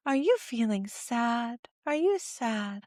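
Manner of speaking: slow, soft sad question; slow soft voice asking a sad baby; gently sad. Shown as a demonstration. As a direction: mirror a baby's sadness softly.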